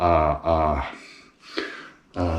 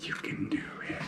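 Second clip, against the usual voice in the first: whispering; 14 dB quieter than usual. Real, not acted. Whispered encouragement at the fridge.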